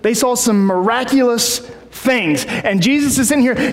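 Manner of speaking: ordinary talk, nothing marked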